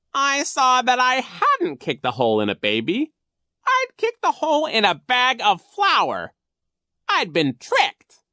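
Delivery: high, loud villain storyteller; scheming Coach Genghis. A narrator's reading. Narrator plays Coach Genghis.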